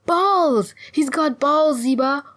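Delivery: high, loud delight; jubilant cry. Acted. Sulon tells her tribeswoman of their luck, jubilant. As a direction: shout the good news, overjoyed. Delighted discovery, calling to friend.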